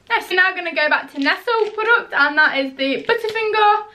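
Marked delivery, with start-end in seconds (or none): none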